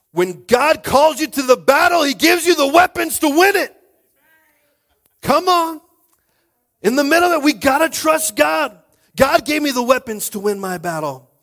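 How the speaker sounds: loud, high-pitched preacher's shout; full-force preaching. Real, not acted. Pastor telling the church God arms them for battle, shouted preaching. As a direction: belt it out to the whole congregation.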